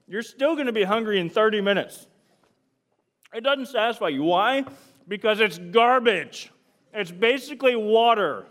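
loud, driving preacher voice; preaching at full force. Acted. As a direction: hammer the point home to the youth. Preaching hard about lettuce.

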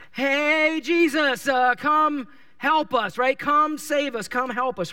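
loud, high call across a distance; yelling from afar. Retold or imitated. Lepers shouting to Jesus from a distance, loud and high. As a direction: shout so he hears you far off. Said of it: "they had to yell".

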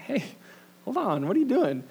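startled high call; mock-startled hey, hold on; alarmed. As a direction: homeowner stopping the stranger, startled and high. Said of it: "and I'm like".

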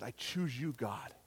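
low, soft prayer; subdued, hushed declaration; quiet resolve. Retold or imitated. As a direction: say it softly and simply to God. Retold prayer after getting up.

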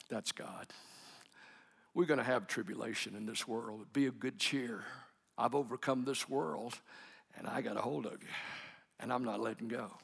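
softly, almost unvoiced at the start; low and quiet, a private realisation; hushed inner thought. Father hearing God after the hospital, hushed and quiet.

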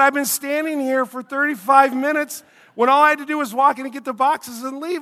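exasperated complaint. Retold or imitated. Man retelling to the congregation his complaint to the clerk, exasperated and loud. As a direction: let the old frustration boil up again.

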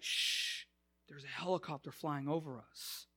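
retold hush, soft and voiceless at the start; hushed warning. The paranoid father hushing the pastor, soft and voiceless. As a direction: shush him like the sky is listening.

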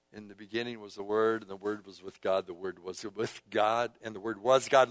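low, soft, reflective recitation; subdued, reverent reading; hushed scripture reading. Real, not acted. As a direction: repeat the verse quietly, as if pondering it.

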